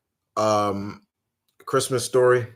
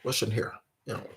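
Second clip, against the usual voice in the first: imitating Jimmy Stewart; 9 dB quieter, faster than usual. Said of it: "his voice cracked it".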